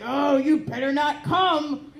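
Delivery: a stern father scolding, half laughing; yelling dad.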